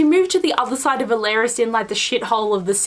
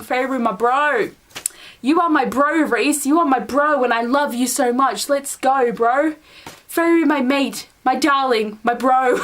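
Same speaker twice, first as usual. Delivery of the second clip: Aussie bro parody; slower than usual. Retold or imitated. A vlogger mocking Rhys's mate line as an Aussie bro. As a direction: rib the quote as a mate would. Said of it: "it's pretty much like going".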